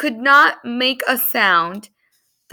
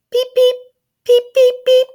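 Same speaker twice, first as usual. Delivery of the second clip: very high, louder peeping chorus; chick peeps; 5 dB louder than usual. Acted. The little chickens running around the incubator, high peeps. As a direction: peep brightly like a bunch of baby chicks. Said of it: "they all said very softly".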